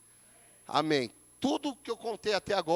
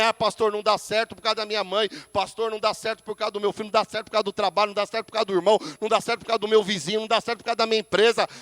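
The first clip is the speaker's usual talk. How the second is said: rattled-off whiny excuses, fast; mock excuses; 2 semitones higher, 4 dB louder, much faster than usual. Pastor mimics members blaming everyone else, fast. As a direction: reel off the excuses like a complaining member.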